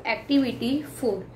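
ordinary talk, nothing marked

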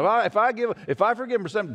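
high, pleading protest; Peter's objection. Retold or imitated. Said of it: "And Peter's going".

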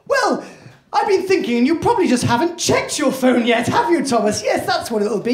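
high, insistent self-reassurance; hammed-up anxious denial on voicemail; mum in denial. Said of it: "is denial".

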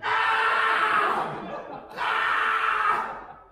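high-pitched mock yelp; comic scream. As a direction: scream high as if taken by surprise. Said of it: "My impression of me while the giraffe is having sex".

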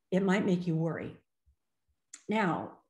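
usual speaking voice

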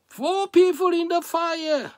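high, loud preacher's cry; excited exclamation. Real, not acted. Preacher tells congregation of the miracle in the fire, excited exclamation.

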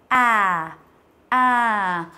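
à with rounded lips, long and slow. Shown as a demonstration. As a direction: round the mouth, stretch à out. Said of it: "mình sẽ tròn miệng đọc".

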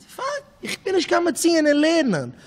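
high-pitched acted protest; protesting pupil. A pupil objecting that he cannot manage learning, high-pitched protest. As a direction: plead it high, as the struggling pupil.

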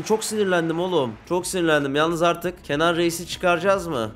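ordinary talk

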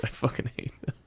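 whispered through laughter; laughing whisper. Real, not acted. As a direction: giggle it under your breath. Ranting about Taco Bell, laughing.

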